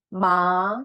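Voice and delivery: ma rising upward; second tone ma. Shown as a demonstration. Teacher showing rising ma. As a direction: lift ma up. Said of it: "artinya serat".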